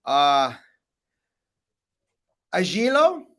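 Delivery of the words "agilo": "agilo" guessed with a rising, questioning end; botched Italian attempt. Shown as a demonstration. American guest tested on Italian pronunciation, "agilo" as an unsure guess. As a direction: try "agilo" as an unsure American reader, higher. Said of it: "your best Italian accent".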